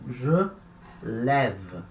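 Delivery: "je" rounded, then "lève" with open "eh"; E aberto. Shown as a demonstration. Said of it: "vira E". ("lève" separado, com E bem aberto, como em "pé")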